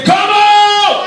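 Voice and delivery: loud preacher's cry of come out; shouted call. Real, not acted. Preacher to congregation, loud call to come out. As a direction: call it out to the neighbor. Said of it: "Let me shout for your neighbor".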